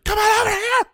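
high, loud calling out across a yard; shouted call. Shown as a demonstration. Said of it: "I would not call that yelling".

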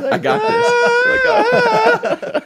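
very slow, high, loud hammed-up wail; triumphant toddler. Host voicing the toddler turning on the water, hammed-up triumph. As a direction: stretch it out like a proud little kid.

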